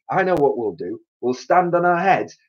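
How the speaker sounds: proud of his idea; eager plan. A narrator's reading. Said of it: "cried Mister Twit".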